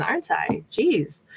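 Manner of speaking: normal talking voice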